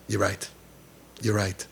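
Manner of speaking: low, soft admission with long pauses; quiet concession. Retold or imitated. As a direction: concede quietly, leaving space between phrases. Rabbi's humbled concession retold.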